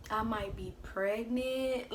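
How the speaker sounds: slow, halting "I might be pregnant"; slow hesitant confession. Retold or imitated. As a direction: drag out each word like you're scared to say it. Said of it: "I literally said it like that".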